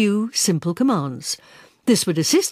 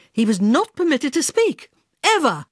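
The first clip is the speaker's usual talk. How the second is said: stern decree; 6 semitones higher than usual. A narrator's reading. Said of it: "Eunice erupted".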